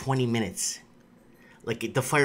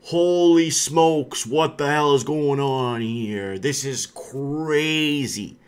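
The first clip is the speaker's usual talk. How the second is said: slow, drawn-out mock disbelief; hammed-up shocked pundit; mock alarm; much slower than usual. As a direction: stretch it out like a stunned reporter. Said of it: "the other side of the media saying".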